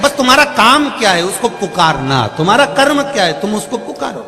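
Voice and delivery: high, loud fervent preaching; preaching at full force. Acted. Preacher urging devotees to keep calling on God, high and loud. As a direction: drive the point home to the crowd.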